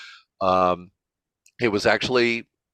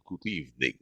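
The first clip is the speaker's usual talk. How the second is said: Dracula greeting; 7 semitones lower, 11 dB quieter than usual. Retold or imitated. Dracula greeting the audience on stage, low and soft. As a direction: greet them like an old-world vampire. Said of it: "my Dracula came out".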